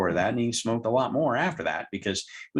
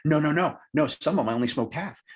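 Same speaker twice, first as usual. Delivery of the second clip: quick, higher no no no; mock protest; 7 semitones higher, faster than usual. Smoker client corrects the count in quick, mock protest. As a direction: hurry to correct him, a touch indignant.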